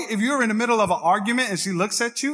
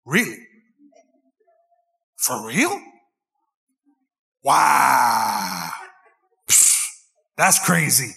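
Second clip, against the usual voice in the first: lower, hammed-up fake interest; mock-interested stock replies, lower; mock attentive listening; 7 semitones lower, much slower than usual. Shown as a demonstration. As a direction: play the nodding husband, low and put-on. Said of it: "you just gotta go on repeat".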